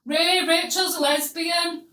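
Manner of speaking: imitating grandma yelling through the doorway; grandma bellowing the news to grandad; calling out. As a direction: call it out slow and loud, half laughing. Grandma calls through the door.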